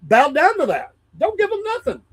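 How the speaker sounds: high, pushed-up indignant rebuke; arguing with his wife about the store; indignant outburst. Retold or imitated. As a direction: scold the wife in a high, disbelieving voice. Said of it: "I said".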